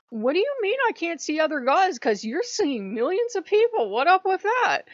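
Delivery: high, loud indignant challenge; loud, high-pitched complaint; mock indignation. Acted complaint to a god.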